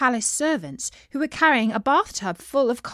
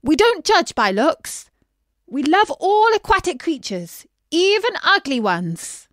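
coaxing call; 5 semitones higher, 4 dB louder than usual. A narrator's reading. Princess coaxing the shy fish out. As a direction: coax gently, like calling a shy pet. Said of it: "said Beatrice".

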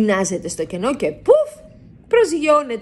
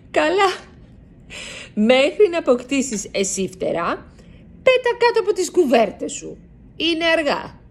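a lightly voiced mother, higher and gently firm; mother's voice; 9 semitones higher, 4 dB louder than usual. A narrator's reading. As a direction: mom sending her son to bed. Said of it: "λέει η μαμά του".

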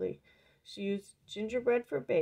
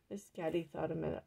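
ordinary delivery, nothing marked

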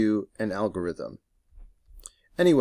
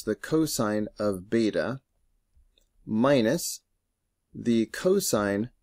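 slow, deliberate, word by word; over enunciating. Shown as a demonstration. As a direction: spell out each term slowly. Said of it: "let me say that more slowly".

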